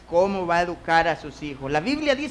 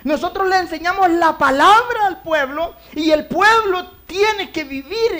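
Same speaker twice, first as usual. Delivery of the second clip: loud, high preaching cry; 9 semitones higher, 8 dB louder than usual. Acted. Preacher calling out to the congregation, loud. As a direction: preach it out to the church.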